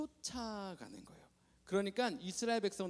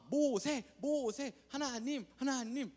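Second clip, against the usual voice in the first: excited cheering; 7 semitones higher, 3 dB louder, much slower than usual. Retold or imitated. Crowd cheering Moses.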